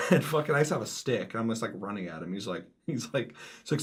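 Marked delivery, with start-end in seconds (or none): none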